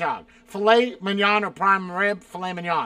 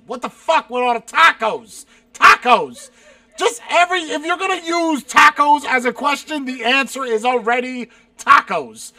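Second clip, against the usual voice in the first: loud, high-pitched rant; exasperated shouting; 5 semitones higher, 10 dB louder than usual. Real, not acted. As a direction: yell it out in real exasperation. Exasperated by the repeated tacos question.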